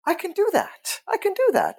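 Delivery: very high, delighted exclamation; excited realization. Retold or imitated. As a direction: voice shoots up as it sinks in.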